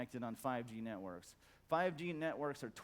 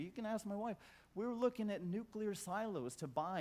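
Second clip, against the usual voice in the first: usual speaking voice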